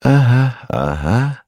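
lightly voiced absent-minded assent; yessing along. A narrator's reading. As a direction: agree automatically in two little grunts. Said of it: "поддакивал профессор".

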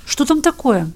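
plain reading voice

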